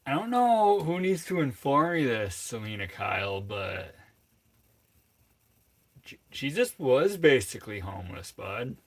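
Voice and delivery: loud indignant rant at chat; indignant comeback. Real, not acted. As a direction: fire back at the insult, loud and high. Retorting to a chat insult.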